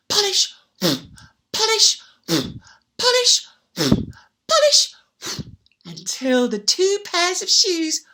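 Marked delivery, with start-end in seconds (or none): 0.1-4.9 each polish higher and louder; building polish. A narrator's reading. Storyteller building as the elves polish the shoes. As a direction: build to the finish.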